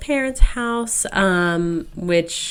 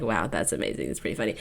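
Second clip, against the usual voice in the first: dry mock approval; 11 semitones lower, 11 dB quieter, faster than usual. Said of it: "she's like".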